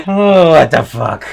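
swearing through laughter; laughing disbelief. Real, not acted. Player reacting to a game mishap, laughing in disbelief. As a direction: blurt it out as you break up laughing.

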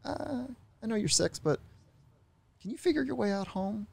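high-pitched, hands-off parent imitation; laissez-faire parent. Parent sending six-year-old off to find home, laissez-faire. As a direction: voice a lax parent up high, hesitant at first. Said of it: "laissez-faire parenting is like".

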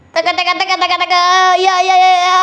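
high rattling "tek" bursts into yelps; pretend gunfire. Host pretending to shoot child, excited gunfire noises.

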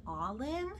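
puzzled Canadians. Retold or imitated. Imagined Canadians querying her son's name, puzzled rising question. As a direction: query the name as a puzzled listener, pitch climbing. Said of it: "I feel like Canadians would be like".

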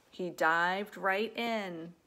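usual speaking voice

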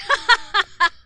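loud high burst of laughter. Real, not acted. Streamer laughing at own oops, loud high laugh. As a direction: laugh out at a clumsy mistake.

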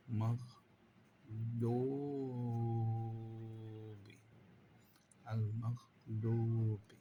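slow Quranic chant; drawn-out recitation. Shown as a demonstration. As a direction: stretch the word as in recitation. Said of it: "teacher demonstrates recitation". (ধীর তিলাওয়াতের সুর)

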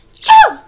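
breathy gasp then a loud high sneeze; gigantic sneeze. Acted. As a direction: let a big sneeze rip. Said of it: "another gigantic sneeze".

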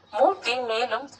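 ordinary delivery, nothing marked

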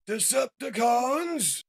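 loud, high, drawn-out call; exclaimed rallying cry. A narrator's reading. Shockwave rallies troops as he transforms, exclaiming. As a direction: shout it to the whole army. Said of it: "Shockwave exclaims as he transforms".

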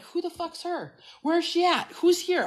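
high-pitched puzzled questions; baffled retelling. Retold or imitated. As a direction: act out looking around, totally confused. Retelling her baffled search for someone.

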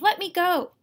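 high, loud, desperate; screamed protest. A narrator's reading. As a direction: yell it like a child fighting free. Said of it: "she screamed".

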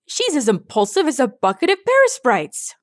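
high-pitched flabbergasted character voice; exclaiming. A narrator's reading. A fleeing pony about Rainbow's recklessness, exclaiming. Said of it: "one pony exclaimed".

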